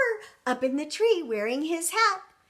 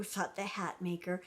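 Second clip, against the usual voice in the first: plain reading voice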